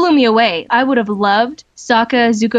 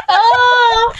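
laughing shout; 13 semitones higher than usual. Real, not acted. Reacts to friend's outburst.

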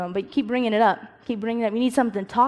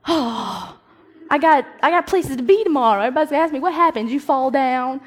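whiny complaint; 5 semitones higher, 5 dB louder than usual. Pretend. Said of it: "I'd be like".

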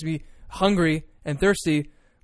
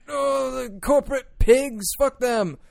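high, slow mocking protest shout; hammed-up protester yell; 8 semitones higher, slower than usual. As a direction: lampoon the sign-wavers.

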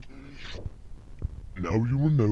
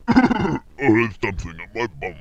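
ordinary delivery

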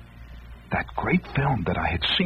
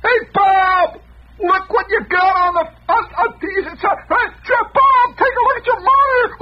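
much higher, louder frantic yell; hollering; 5 dB louder than usual. Retold or imitated. Hollering to the engineer in the next room, frantic yelling. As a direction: shout for Bob across the room in a panic. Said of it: "I hollered into the next room".

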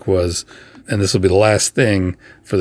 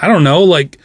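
flustered; 9 semitones higher, 6 dB louder than usual. Retold or imitated. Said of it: "I'm like".

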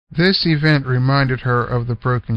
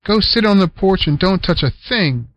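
stern order; 6 semitones higher, 3 dB louder than usual. Acted. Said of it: "ordered Selma".

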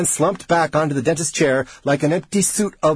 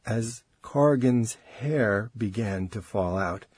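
ordinary delivery, nothing marked

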